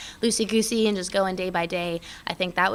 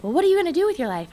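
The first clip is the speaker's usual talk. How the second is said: mom; 11 semitones higher, 5 dB louder, faster than usual. Retold or imitated. Mom questioning daughter's withdrawal.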